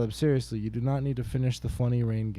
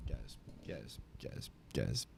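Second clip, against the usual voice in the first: whisper; 12 semitones lower, 14 dB quieter than usual. Pretend. Breakdown, frantic whispered plea.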